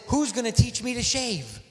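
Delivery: put-on a touch of teenage sass; smart-mouthed. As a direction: get smart with God. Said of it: "I got smart with God".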